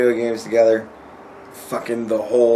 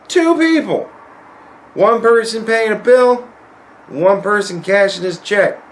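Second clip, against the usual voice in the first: high-pitched disbelief; complaining about the wait, voice climbing high; exasperated ranting; 8 semitones higher, 4 dB louder than usual. Real, not acted. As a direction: stress two in exasperation. Venting about waiting an hour.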